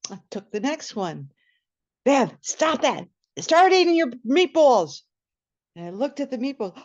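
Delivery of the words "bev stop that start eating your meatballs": slow, louder scolding brother. Retold or imitated. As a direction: tell her off firmly.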